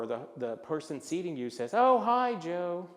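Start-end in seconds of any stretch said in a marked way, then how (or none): 1.7-2.9 loud, high, delighted hello; gushing greeter. Acted. Restaurant host greeting a regular, gushing. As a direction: greet a favourite regular with a big bright hello.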